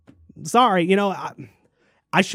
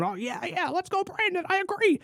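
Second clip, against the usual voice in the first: put-on high, soft dazed old man; confused Biden impression; 12 semitones higher, 8 dB quieter than usual. Mocking Biden agreeing on the phone, high and soft. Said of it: "sitting there on the end of that phone call going".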